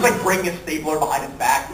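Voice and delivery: usual speaking voice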